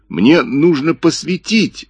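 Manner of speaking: slow, squeezed high voice; strained voice. A narrator's reading. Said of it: "сдавленно проговорил Джипа". (сдавленный голос)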